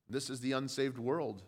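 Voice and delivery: normal talking voice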